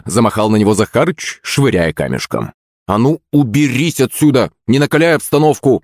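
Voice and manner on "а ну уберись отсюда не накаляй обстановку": raised-pitch irritated command; scolding shout. A narrator's reading. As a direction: snap at a pesky parrot. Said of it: "ментально прикрикнул я на попугая". (прикрикнуть)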